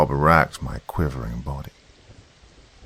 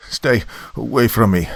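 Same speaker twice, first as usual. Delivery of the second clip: higher, louder frightened warning; shaky warning; 6 semitones higher, 5 dB louder than usual. A narrator's reading. Warning Zudov off down the mouthpiece, shaky voice. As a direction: warn them off in a shaky voice. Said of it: "I warned, my voice shaky".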